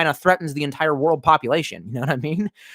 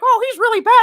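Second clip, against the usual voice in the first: much higher, laughing mock warning; 5 dB louder than usual. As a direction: parody the show's fake alarm through a laugh. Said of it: "make it seem like".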